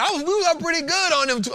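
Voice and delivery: exasperated. Real, not acted. Ranting about sold winners.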